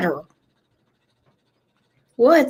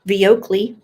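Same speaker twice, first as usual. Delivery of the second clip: ordinary delivery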